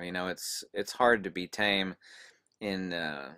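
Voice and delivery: normal talking voice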